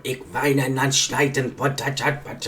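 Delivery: high, mock-angry German rant; imitated German yelling. Melanie yelling at him in German for five minutes, mock furious. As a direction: imitate being chewed out in harsh German. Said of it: "straight yelled at me".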